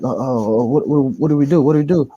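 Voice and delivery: stammering, rushed, rising mock panic; acted panic. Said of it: "we always panicking like this".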